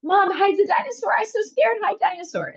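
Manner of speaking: high-pitched mock plea of a frightened little girl; imitating scared toddler. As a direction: plead like a terrified two-year-old, in imitation. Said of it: "screaming, crying. And she kept saying".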